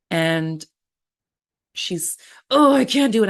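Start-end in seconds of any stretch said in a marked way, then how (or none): 2.5-3.3 high, loud imitation of a weeping mother in labor; mock crying client. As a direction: wail it like she's given up, hammed-up. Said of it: "Stop crying".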